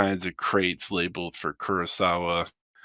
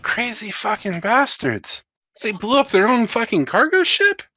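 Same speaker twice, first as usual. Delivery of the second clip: much higher, outraged disbelief; incredulous crew member; 12 semitones higher, 4 dB louder than usual. Acted. Hangar crewman reacting to the self-destruct, high incredulous cry. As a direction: squawk it like a stunned dockhand. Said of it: "hangar bay go".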